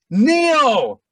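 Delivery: loud high call of a name across a courtyard; retold scream of a name. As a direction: shout Neil to catch his attention far off. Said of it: "Screams his name across the courtyard".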